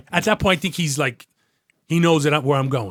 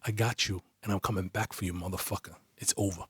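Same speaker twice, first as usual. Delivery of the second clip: low, hushed menace; quiet, unhurried retold threat; whispered threat; 5 semitones lower, 10 dB quieter than usual. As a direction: say it softly into his ear. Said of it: "and I whisper in his ear".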